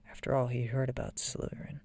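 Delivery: usual speaking voice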